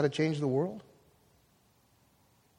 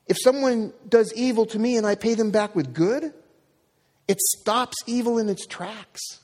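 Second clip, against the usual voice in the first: high, urgent preaching; impassioned preaching; 8 semitones higher than usual. Acted. Pastor exhorting the congregation, passionate and raised. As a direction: drive the message home to the church.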